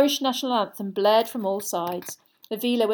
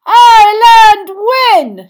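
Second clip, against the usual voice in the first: huge, high, drawn-out victory call; announcer's shout; 12 semitones higher, 18 dB louder, much slower than usual. A narrator's reading. Commentator announces the winner to the crowd, long shout. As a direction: stretch it out across the stadium. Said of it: "shouted Bagman".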